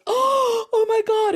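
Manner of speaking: very high, loud gasp of wonder; be struck by the beauty; awed. Retold or imitated. Old woman seeing the cosmos, very high awed gasp. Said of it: "the woman goes".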